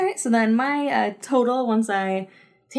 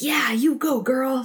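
breathy whispered cheer, higher; cheering her on; 6 semitones higher, slower than usual. Retold or imitated. Ten-year-old cheering Scully on, hushed and high. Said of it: "just being like".

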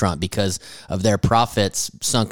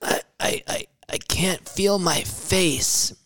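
slow, soft, halting numb voice; numb stunned voice; 9 semitones higher, 6 dB quieter, slower than usual. Retold or imitated. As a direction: stammer out dazed and numb. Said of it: "the guy's like".

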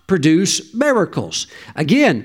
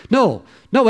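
ordinary talk, nothing marked

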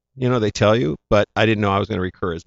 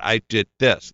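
usual speaking voice

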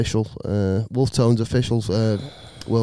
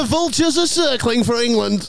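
mock commentator; 10 semitones higher than usual.

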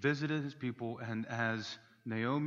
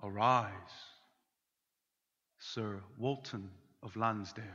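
solemn regal knighting; 6 semitones lower, 5 dB quieter than usual. Retold or imitated. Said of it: "if I were knighted, one would say".

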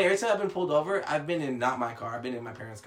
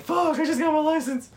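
mock panic; 7 dB louder than usual. Retold panic after the ticket.

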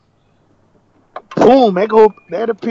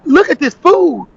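higher-pitched mock reprimand; retold scolding; 7 semitones higher, 3 dB louder than usual. Manager pointing cook to the food, scolding. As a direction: imitate an indignant order, pitched up. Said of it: "why are you talking like this".

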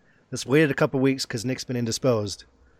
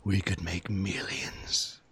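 evil villain voice; 3 semitones lower, 7 dB quieter, much slower than usual. Acted.

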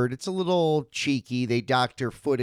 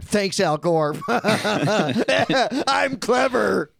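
loud, high hammed-up jokester with a laugh; mock self-congratulation; 7 semitones higher, 4 dB louder, much slower than usual. Host mocks people who thank Al Gore for the internet, hammed-up crowing. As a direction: crow it like a pleased wisecracker, laughing. Mocking a stale internet joke.

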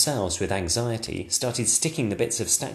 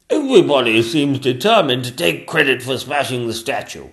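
king voice; 4 semitones higher, 8 dB louder than usual. Acted. King remarking.

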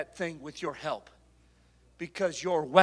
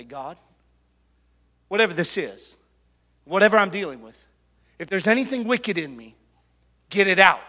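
prayer; 10 dB louder than usual. Acted.

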